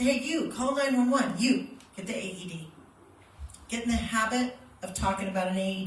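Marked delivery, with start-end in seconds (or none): 0.0-2.7 firm loud orders, pointing at each one; commanding bystanders. Shown as a demonstration. Instructor shows how to give bystanders orders, firm commanding voice. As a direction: direct order to a specific person. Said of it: "you're gonna say".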